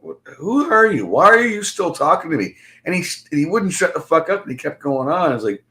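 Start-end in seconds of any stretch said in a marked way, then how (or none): 0.0-2.6 high-pitched, incredulous questions; exasperated retort. Retold or imitated. Replaying his answer to the man, exasperated. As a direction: throw the questions back, pitched well up.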